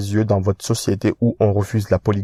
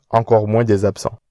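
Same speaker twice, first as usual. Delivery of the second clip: normal talking voice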